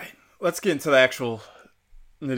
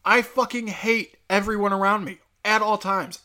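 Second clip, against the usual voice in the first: normal talking voice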